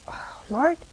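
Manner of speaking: breathy, near-whispered sigh to God; hushed prayer. Acted. Speaker turns to the Lord while examining her conscience, hushed. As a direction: murmur it as a small private plea.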